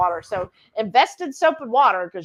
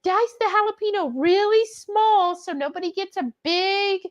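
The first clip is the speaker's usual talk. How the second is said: imitating Mary; 9 semitones higher than usual. Imitating Mary's cooking tip.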